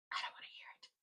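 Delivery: breathy whispered repeat; I don't wanna hear it, whispered under the breath. Real, not acted. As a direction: drop to a whisper as an aside.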